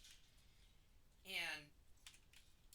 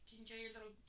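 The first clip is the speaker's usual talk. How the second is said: pet talk; 4 semitones higher, 5 dB quieter than usual. Real, not acted. Talking sweetly to her pet.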